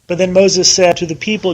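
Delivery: normal talking voice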